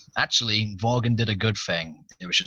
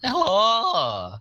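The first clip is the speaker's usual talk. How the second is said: squeaky mock hello; 13 semitones higher than usual. Mocking the greeting again.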